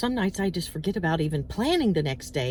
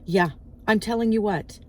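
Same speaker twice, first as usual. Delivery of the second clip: ordinary talk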